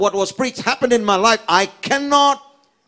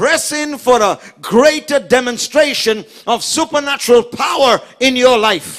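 raised, emphatic exhortation; full-force preaching; 6 semitones higher, 5 dB louder than usual. Acted. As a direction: urge the congregation onward. (impassioned preacher's charge)

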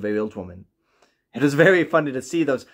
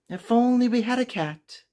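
put-on higher, wistful old woman voice; old woman's wish; 9 semitones higher than usual. Lonely old woman to her husband, wishing for a cat.

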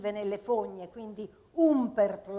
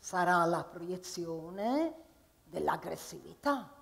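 lower, slow, softer pronouncement; grave doctor; 4 semitones lower, 5 dB quieter, slower than usual. Acted. Psychiatrist diagnosing the patient in a low grave tone. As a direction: answer like a solemn analyst.

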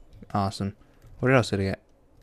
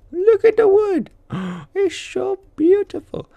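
squeaky, much higher gushing voice with a gasp; mock adoring voice; 4 dB louder than usual. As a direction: gush over it in a silly falsetto.

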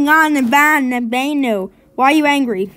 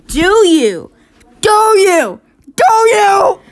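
louder each time, rising; building taunt; 4 semitones higher, 6 dB louder, slower than usual. Acted. Character taunting the other, building louder. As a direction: push it harder each repeat.

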